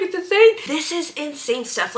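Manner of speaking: high, loud cry of disbelief; shocked outburst. Real, not acted. To her viewers, reacting to the dark plot with a shocked high cry. Ranting about dark book.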